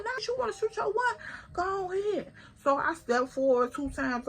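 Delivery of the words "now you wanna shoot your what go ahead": much higher, fast mocking dare; incredulous dare. Daring the girl to swing, high and fast. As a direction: scoff at her and call her bluff. Daring rival in retold fight.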